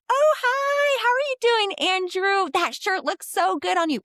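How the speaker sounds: put-on high, singsong baby-talk voice; gushing, sugary high-pitched baby talk. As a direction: coo it loud and high, as if to a small child. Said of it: "baby talk kind of voice".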